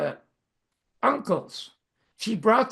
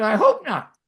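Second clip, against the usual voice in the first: loud, higher retold retort; emphatic retort; 5 semitones higher, 9 dB louder than usual. Boy to friend's mother about his mother returning, emphatic retort. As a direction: punch it out as an emphatic comeback.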